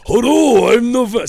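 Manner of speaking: put-on very high, loud comic Swedish accent; mock Swedish accent. Comic introducing himself as a Swede, high mock Swedish accent. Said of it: "That's a Swedish accent".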